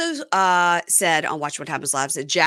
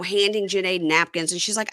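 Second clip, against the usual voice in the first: usual speaking voice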